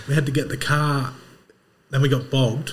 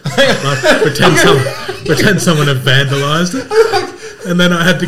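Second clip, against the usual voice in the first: laughing; 6 semitones higher, 11 dB louder, slower than usual. Real, not acted. Laughing at own prank.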